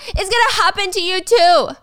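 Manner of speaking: high and loud angry taunt; furious taunt. Retold or imitated. Gia yelling a furious taunt at Stacy, high and loud. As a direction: throw it at her like a curse.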